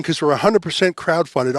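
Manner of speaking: ordinary delivery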